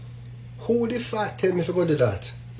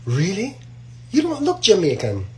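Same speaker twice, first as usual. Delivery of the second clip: higher-pitched surprised woman; mock disbelief; 5 semitones higher than usual. Woman doubting a new acquaintance is Jamaican, mock disbelief. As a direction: voice her doubt with a lifted pitch.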